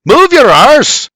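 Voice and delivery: high, loud, drawn-out bark; barked order. A narrator's reading. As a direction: bark at him to hurry.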